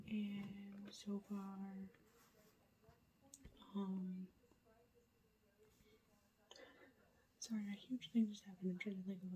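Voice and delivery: hushed late-night whisper to the camera; whispered update. Real, not acted. Vlogger whispering a reading update at night, hushed whisper. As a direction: keep it all breathy and hushed so nobody wakes.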